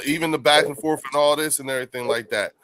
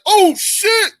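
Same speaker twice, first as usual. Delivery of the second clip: much higher, louder shocked yell; hyped-up exclamation; 10 dB louder than usual. Retold or imitated. Host imitating late friend reacting to the five hundred jab, yelling. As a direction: yell it like a fan watching a big play. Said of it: "he'd have been like".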